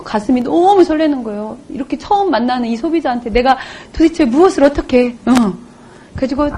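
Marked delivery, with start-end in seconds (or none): none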